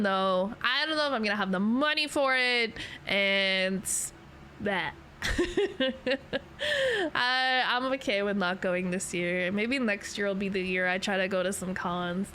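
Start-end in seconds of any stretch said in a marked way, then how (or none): 5.2-7.1 high giggle, falling off. Real, not acted. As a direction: laugh it away. Laughs about money.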